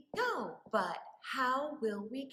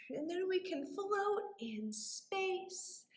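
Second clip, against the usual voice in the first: dreamy float; 4 semitones higher, 7 dB quieter than usual. Shown as a demonstration. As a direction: float the words softly. Floating in space, softly.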